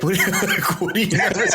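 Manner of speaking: laughing disbelief. Retold or imitated. Friends react to nervous Aussie, laughing disbelief. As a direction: break into laughter at the absurdity. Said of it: "And we're like".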